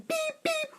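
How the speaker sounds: very high-pitched horn beeps; imitated car honks. Line of cars honking at wrong-way driver, high beeps. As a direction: squeal like car horns. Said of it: "they were all honking at me".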